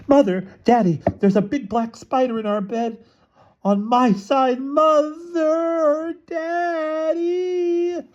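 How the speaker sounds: put-on high-pitched child's scream for parents; panicked little-girl shriek. A narrator's reading. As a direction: scream it in alarm, very high, drawing out the last calls. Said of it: "went screaming into her mother and father's room".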